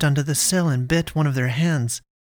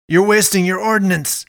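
louder, higher angry shout; urgent, raised yell; 6 semitones higher, 6 dB louder than usual. A narrator's reading. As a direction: shout it across to the men. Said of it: "Dushan shouted to them".

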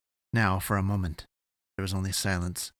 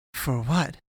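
higher, startled question with a rising end; almost aghast; 6 semitones higher, 3 dB louder than usual. Acted. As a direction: she can't believe he's thanking her. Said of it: "almost aghast".

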